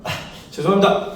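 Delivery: normal talking voice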